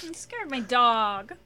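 high, loud, slow whine; drawn-out loud high whine; imitating Jeffrey. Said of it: "It's so funny".

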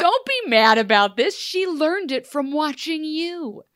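loud, then slow high reproach; mock scolding. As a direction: scold him like a fed-up friend. Scolding Rumple in jest.

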